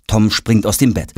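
usual speaking voice

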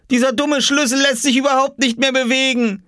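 put-on high, exasperated boyish complaint; frustrated call. A narrator's reading. Oskar about the stuck key, calling back frustrated. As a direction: complain loudly through a door. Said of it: "rief Oskar zurück".

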